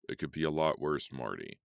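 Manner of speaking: ordinary delivery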